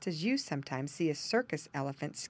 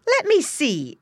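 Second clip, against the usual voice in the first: high, loud eager wife; high eager farm wife voice; eager exclaim; 11 semitones higher, 8 dB louder, slower than usual. A narrator's reading. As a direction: she hurries over to look. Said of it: "exclaimed the farmer's wife".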